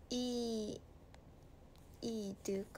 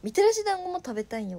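high, fast, loud craving for dango; eager craving; 8 semitones higher, 8 dB louder, faster than usual. Real, not acted. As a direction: blurt out the craving excitedly. (はしゃいで食べたいと言う)

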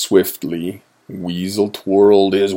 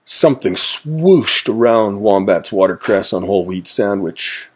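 usual speaking voice

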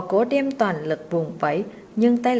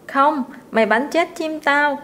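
put-on a boy's sulky protest, pitched higher; whiny angry protest; 6 semitones higher, 3 dB louder than usual. A narrator's reading. Spoiled boy protesting to the servant, sulky and cross. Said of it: "nhăn mặt cháy lên".

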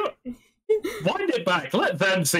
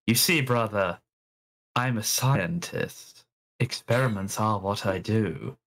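lightly lowered, slow character voice; a hint of a deep brother figure; 7 semitones lower, slower than usual. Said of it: "let me see if I can get my".

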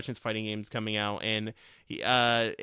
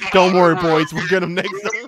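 loud radio-squad soldier call; rally the squad over comms; 5 semitones higher, 13 dB louder than usual. Retold or imitated. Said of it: "Modern Warfare two, and you're about to say".

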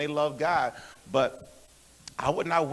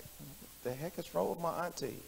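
much softer, dropped-down voice; baffled aside; 15 dB quieter than usual. Retold or imitated. Nephew puzzled by his starstruck auntie, quiet baffled aside. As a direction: say it under your voice, as if to yourself.

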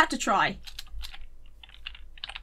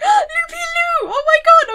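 very high, drawn-out squeal of joy; excited shriek; 8 dB louder, slower than usual. Retold or imitated. Finding her old doll in the attic, squealing with joy. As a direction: shriek like finding a lost treasure. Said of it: "I got so happy and excited".

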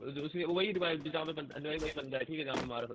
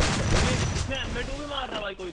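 frustrated shout; 8 semitones higher, 7 dB louder than usual. Real, not acted. Getting shot, frustrated outburst.

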